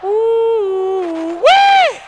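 high, loud whoop; loud delighted yelp; excited holler. Acted. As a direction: whoop at someone's flashy outfit. Hollering at audience outfit.

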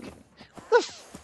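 high, slow, breathy half-swallowed curse; startled gasp. Real, not acted. Player startled by the glitching cow, high stunned curse. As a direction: caught totally off guard by a glitch.

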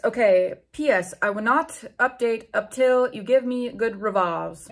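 usual speaking voice

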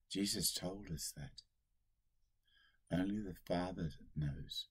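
usual speaking voice

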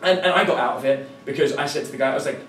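normal talking voice

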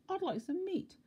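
tiny, very high squeaky voice; high-pitched mock whisper; timid whisperer. A narrator's reading. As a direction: squeak it like a timid little man. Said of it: "he whispered".

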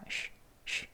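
쉬 as soft unvoiced sh, lips pushed forward; rounded-lip sh. Shown as a demonstration. Teacher shows lip shape, 쉬 a soft voiceless sh. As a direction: let air out on 쉬 with rounded lips. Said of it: "입술이 살짝 앞으로 쉬라고 할 때요".

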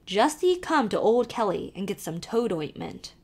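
put-on lively Irish accent, calling to the horse; old Irish driver calling out; Irish carter. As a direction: urge the nag on in a brogue. Kelly offers advice.

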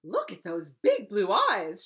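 loud, slow, proud exclamation; excited shout. A narrator's reading. King admiring his newborn daughter, excited shout. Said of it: "shouted the king".

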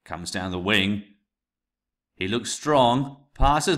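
building play-by-play, rising toward the shot; commentator build-up. Shown as a demonstration. Teacher recording test narration, building commentator excitement. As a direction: call the attack as it builds.

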